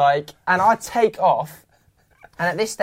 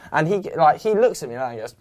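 ordinary talk, nothing marked

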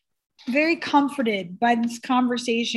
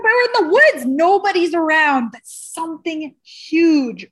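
excited telling; 4 semitones higher, 6 dB louder than usual. Real, not acted. Building the scary story.

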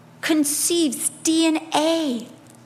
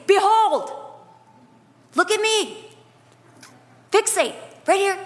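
voice of God; 6 semitones higher than usual. Acted. God calling panicking believers, commanding and higher. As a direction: command them to fix their eyes. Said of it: "And God has to say".

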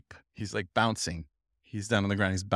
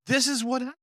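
much higher, excited outburst; astonished discovery; 11 semitones higher than usual. Retold or imitated. New reader to his host on recognising himself, astonished. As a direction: imitate a man amazed at what he reads.

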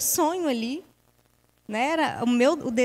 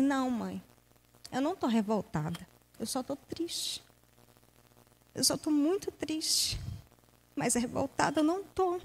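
tearful reply; 2 semitones higher, 7 dB quieter than usual. Retold or imitated. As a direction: answer mom quietly through tears. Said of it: "eu chorando". (resposta baixinha e chorosa à mãe)

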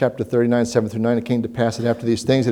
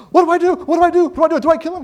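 panicking king; 6 dB louder, much faster than usual. Retold or imitated. King of Israel panics over captives.

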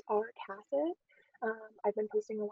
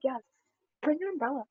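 ordinary talk

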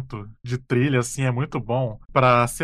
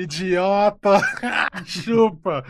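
gloating taunt; 4 semitones higher, much slower than usual. Pretend. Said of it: "você tem que falar".

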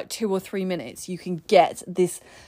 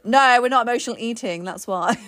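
usual speaking voice

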